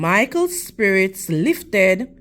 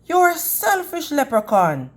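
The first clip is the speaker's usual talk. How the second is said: mild angry accusation, higher pitched; a boy's hurt, angry jab; 8 semitones higher than usual. A narrator's reading. Boy accusing the leprechaun, mildly angry. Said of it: "Michael said angrily".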